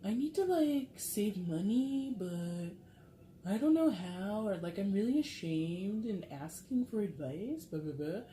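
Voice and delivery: high, hesitant mock client voice; acted sheepish confession, pitched high; ashamed woman client. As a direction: play an embarrassed woman haltingly asking for advice. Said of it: "this woman is just like".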